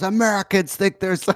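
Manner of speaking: high hyped-up promo, like a wrestler; wrestling promo voice. Acted. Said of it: "Do it like a wrestling promo".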